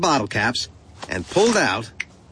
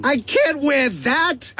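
high, slow cry of refusal; indignant protest; 12 semitones higher than usual. A narrator's reading. Boy refusing uncle's gift, high indignant outburst.

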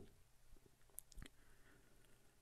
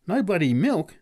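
much higher, louder amazed cry; can't believe it; exclaimed; 9 semitones higher, 6 dB louder than usual. Acted. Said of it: "exclaimed the man".

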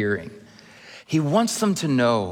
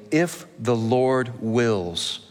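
slow, solemn "If the Lord wills"; much slower than usual. Shown as a demonstration. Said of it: "slowed scripture key phrase".

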